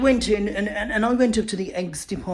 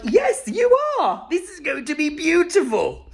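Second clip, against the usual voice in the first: delighted exclamation; 7 semitones higher than usual. Acted. Comic answering the glorious egg yolk, hammed-up high delight. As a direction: gush in a high, swooping voice. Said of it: "And I am like".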